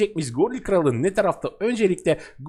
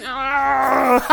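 startled cry; 4 semitones higher, 5 dB louder than usual. Real, not acted. Character dies in game.

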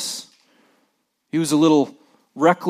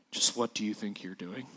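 low, much softer, pointed stern question like a dad over his glasses; stern dad look; 5 semitones lower, 11 dB quieter than usual. Retold or imitated. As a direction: quietly scold like a father raising one eyebrow. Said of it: "my dad has an amazing skill with his eyebrow".

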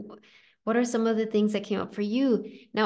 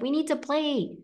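put-on higher, playful little-kid voice; playful inner child; 8 semitones higher than usual. A coach voicing a client's inner child, higher and playful. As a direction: chirp it like an eager child. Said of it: "Your, your inner child might be like".

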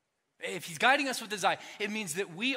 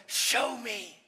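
eager parent; 6 semitones higher, 4 dB louder than usual. Acted. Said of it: "we look back and we go".

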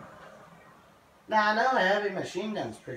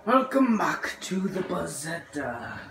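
slow, put-on German accent greeting; slower than usual. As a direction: welcome viewers in a hammed-up German accent. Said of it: "I was doing German accent".

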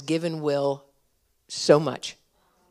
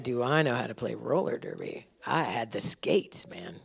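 softer, lower, slowed mock disbelief; incredulous echo; 6 semitones lower, 9 dB quieter than usual. Pastor retelling his reply to Jessica, mock incredulous. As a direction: echo her question like it's absurd.

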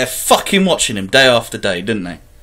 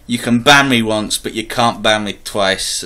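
usual speaking voice

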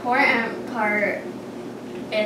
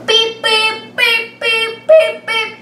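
high loud car beeps; car beeping; 11 semitones higher, 6 dB louder, slower than usual. Shown as a demonstration. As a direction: beep like a toy car. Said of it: "go around like a car and go beep".

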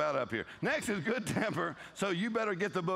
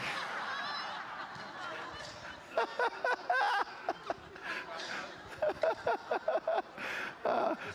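a long, high cackling laugh. Real, not acted. Preacher cracking up at his own joke, long high laugh.

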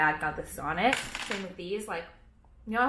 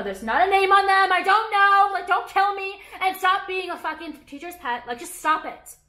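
exasperated outburst; 10 semitones higher, 7 dB louder than usual. Real, not acted. Mock scolding imagined critics.

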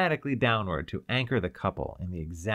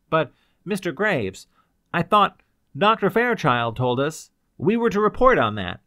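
louder, higher bewildered protest; confused surprise; 7 semitones higher, 8 dB louder than usual. Acted. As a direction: stumble out in disbelief. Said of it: "came confusedly from the maze of his surprise".

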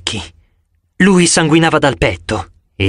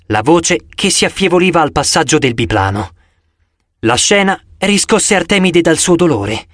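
ordinary delivery, nothing marked

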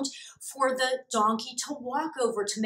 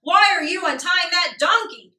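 louder, higher challenging neighbor; suspicious neighbor; 6 semitones higher, 9 dB louder than usual. Acted. Neighbor questioning the strangers taking the donkey. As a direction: call out at the strangers. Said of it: "asked the man who lived next door".

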